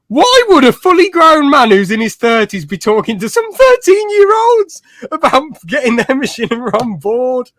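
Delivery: mock disbelief, high pitched; incredulous thought. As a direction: squeak out the disbelief.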